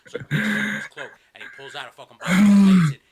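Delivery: ordinary talk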